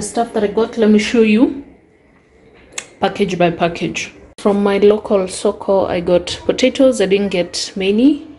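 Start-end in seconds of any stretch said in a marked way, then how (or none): none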